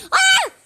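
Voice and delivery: very high, loud startled scream; sudden shriek. Real, not acted. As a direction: shriek as the character drops. Character falls into water.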